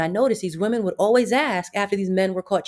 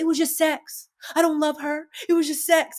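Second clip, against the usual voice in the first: higher pleading man's voice, mock earnest; pleading cheating man; 8 semitones higher than usual. Cheating man pleading with his girlfriend, higher mock plea. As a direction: beg like a caught man pleading for his life. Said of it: "he could be like".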